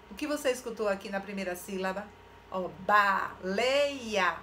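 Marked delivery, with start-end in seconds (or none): 2.8-4.4 "baleia" very slow, broken after "ba"; syllable by syllable. Shown as a demonstration. Teacher asking about first syllable, very slow "baleia". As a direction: draw out "baleia", holding each syllable. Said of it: "O que você escutou na primeira sílaba?".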